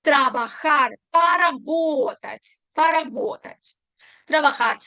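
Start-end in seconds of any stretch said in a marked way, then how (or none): 1.1-3.8 поработать said slowly, stress on the o; поработать slow, po-ra-BO-tat; slow stressed demo. Shown as a demonstration. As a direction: draw out поработать for the class. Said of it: "tilde на о".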